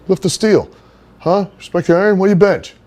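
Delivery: high, loud dopey bro voice; Johnny Canine's voice. Retold or imitated. Johnny greeting Henry, in a high loud bro voice. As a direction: pitch way up and push it out like a meathead buddy. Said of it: "that's how he would talk".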